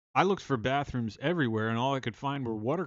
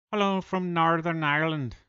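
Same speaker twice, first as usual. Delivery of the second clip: a mock Northern Irish accent, a bit higher and louder; 5 semitones higher, 5 dB louder than usual. Streamer greeting a Northern Irish viewer in a mock Northern Irish accent. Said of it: "I hope you don't find that accent offensive".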